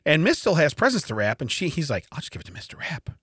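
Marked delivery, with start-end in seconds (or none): 2.1-3.1 whispered under the breath, retelling the son's plan; sneaky whisper. Retold or imitated. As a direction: hush it like a secret scheme.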